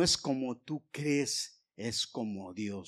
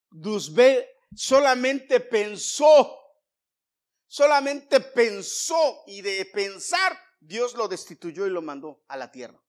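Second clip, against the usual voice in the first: full-force preaching; 8 semitones higher, 14 dB louder than usual. Acted. Preacher telling the congregation of Lucifer's fall, full-force preaching.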